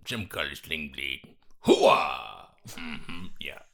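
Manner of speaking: low grunting Hooah mashup; Sling Blade mashup. Retold or imitated. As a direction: growl it like Karl Childers. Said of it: "what a fine mashup".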